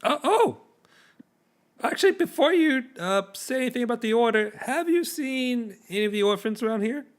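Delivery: pompous soldier asking after orphans; Captain Willie. Acted. Captain Willie questioning the shopkeeper about missing orphans, high officious voice.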